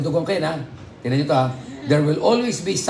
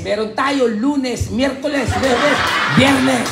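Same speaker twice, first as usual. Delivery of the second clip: laughing joke build-up; 9 semitones higher, 5 dB louder, slower than usual. Acted. Joking men have no period.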